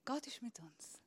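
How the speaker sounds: soft unvoiced whisper. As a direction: breathe it like a secret. [name]'s whispered confidence. (leise geflüstert)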